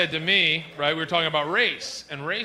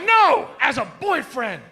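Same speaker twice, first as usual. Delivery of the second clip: loud, high, drawn-out retort; exasperated correction; 8 semitones higher, 6 dB louder, slower than usual. Retold or imitated. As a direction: imitate an exasperated friend, slow and loud. Friend's exasperated correction, acted out.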